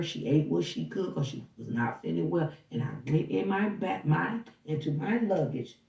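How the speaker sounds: ordinary delivery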